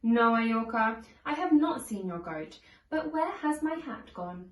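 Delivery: ordinary delivery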